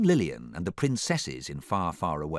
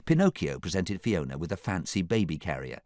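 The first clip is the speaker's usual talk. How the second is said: plain reading voice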